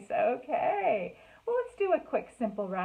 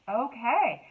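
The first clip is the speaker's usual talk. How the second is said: plain reading voice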